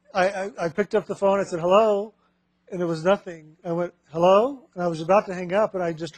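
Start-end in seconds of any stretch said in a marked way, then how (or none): 1.6-2.1 raised, higher "Hello?" into the phone; calling hello. Retold or imitated. Teacher answering a silent phone line, raised call. 4.1-4.7 raised, higher "Hello?" into the phone; calling hello. Retold or imitated. Teacher answering a silent phone line, raised call.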